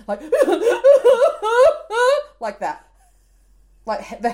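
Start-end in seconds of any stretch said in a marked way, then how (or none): 0.3-2.3 high, loud laughing howl; howling sob. Shown as a demonstration. Mum showing how she howled at school, high and loud. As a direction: demonstrate the heaving howl. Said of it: "I'm howling".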